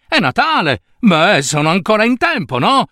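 high, excited burst of relief; delighted. Acted. Scrooge esulta: è Natale. (felice ed eccitato)

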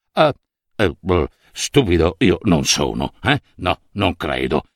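low, hesitant murmur to himself; nervous official thinking. A narrator's reading. Official secretly doubting his own wits, low and hesitant. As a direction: reassure yourself without conviction. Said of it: "pensò il funzionario".

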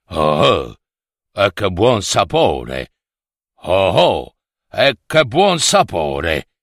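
deep gloomy ogre voice. A narrator's reading. Ogre tasting the wounded prince's blood, deep gloomy booming voice. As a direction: growl it out like a big ogre licking his lips. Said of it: "a quel vocione cupo cupo".

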